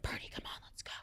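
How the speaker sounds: hushed, urgent dog call; whispered coaxing. Retold or imitated. Hiker luring a dog from its chatting owner, whispered. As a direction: whisper it so the owner won't notice.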